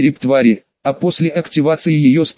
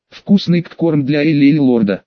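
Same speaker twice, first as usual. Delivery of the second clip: plain reading voice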